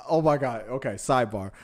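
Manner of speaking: ordinary talk, nothing marked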